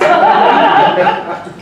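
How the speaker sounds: loud high laugh; big laugh. Real, not acted. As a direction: burst out laughing. Laughs at her own act.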